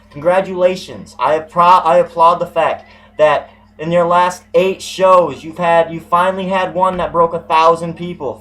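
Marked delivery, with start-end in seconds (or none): none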